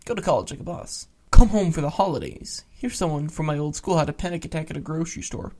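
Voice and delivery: ordinary delivery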